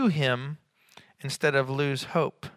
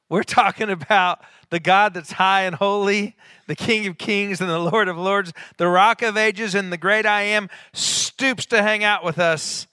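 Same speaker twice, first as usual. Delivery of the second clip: raised, louder roll call of titles; fervent preaching; 6 semitones higher, 7 dB louder than usual. Real, not acted. Preacher exalting the God who stoops to us, fervent. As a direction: build with force, then stretch out the last phrase.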